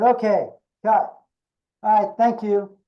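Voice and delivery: imitating a director calling cut; director cutting it off. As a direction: call a halt to the audition. Director cuts the audition short.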